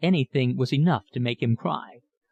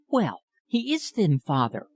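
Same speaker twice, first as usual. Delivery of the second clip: lightly voiced young girl, higher, pleading; remonstrating daughter; 5 semitones higher than usual. A narrator's reading. Sally defends Philip to her father, higher girlish protest. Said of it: "remonstrated Sally".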